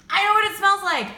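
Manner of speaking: you just figured it out; excited realization. Real, not acted. Realizing the candle smells like sun oil, excited. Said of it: "Oh my God, it just hit me".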